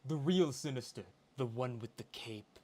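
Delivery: very camp, over-the-top British man; theatrical camp British villain; Sinister. Acted. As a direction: announce yourself with flamboyant British grandeur. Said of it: "this very camp, very over the top".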